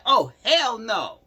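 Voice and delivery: loud, high, heated imitation of her; hammed-up angry girlfriend; mock outrage. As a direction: mimic her yelling at him. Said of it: "She was like".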